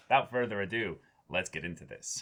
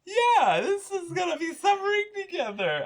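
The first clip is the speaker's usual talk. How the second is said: much higher, sing-song sarcastic cheer; mock cheer. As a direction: fake brightness over a grim prospect. Mock cheer about grim plot.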